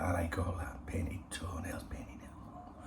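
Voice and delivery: fading unvoiced whisper. Real, not acted. Describing her painted nails to viewers, fading whisper. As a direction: drift into a hushed admiring whisper.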